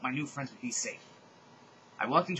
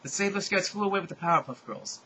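usual speaking voice

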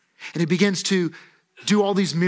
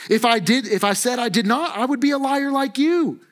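higher, pointed retort; acted confrontation, climbing to the accusation; fighting words; 5 semitones higher than usual. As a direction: throw it back at the accusers. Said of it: "Them's fighting words".

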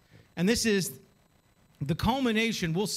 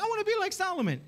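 high-pitched mock-eager voice; mock eager wish; 12 semitones higher, faster than usual. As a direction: mimic everybody's naive wishing. Mimics everyone wishing to be Solomon.

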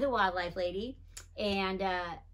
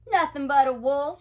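boastful Big Jack; 5 semitones higher, 8 dB louder than usual. A narrator's reading. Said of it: "said Big Jack".

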